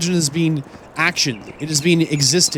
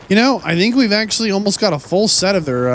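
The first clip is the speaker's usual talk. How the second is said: ordinary talk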